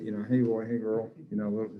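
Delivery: ordinary delivery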